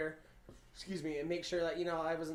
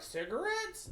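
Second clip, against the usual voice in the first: innocent surprise; 13 semitones higher, much slower than usual. Retold or imitated. Retold confused question to coworkers.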